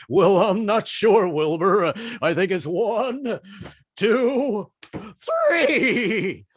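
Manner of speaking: slow, dopey, rising voice; a dim patient counting slowly; drawn-out, foggy count; dazed horse-medicine patient. Retold or imitated. Dazed patient answering EMT.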